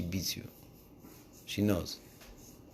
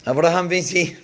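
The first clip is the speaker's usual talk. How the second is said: loud, high call with a laugh; wife calling Abraham over, loud and laughing; 6 semitones higher, 8 dB louder than usual. Acted. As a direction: summon him across the tent. Sarah calls her husband.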